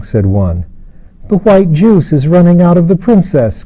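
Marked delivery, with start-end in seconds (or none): 1.3-3.6 put-on a child's hushed awe, raised higher; awed child crying out to the others. A narrator's reading. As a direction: cry it out in wonder. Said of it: "cried another with an awed look".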